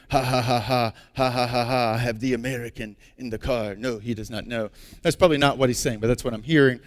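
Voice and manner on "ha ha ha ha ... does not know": a mildly hammed-up villain's laugh, then gloating; maniacal laughing. The driver on his phone gloats about his captive, mock maniacal laugh. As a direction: lightly mimic a gloating captor's ha ha ha. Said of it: "in the maniacal ha, ha, ha-ing".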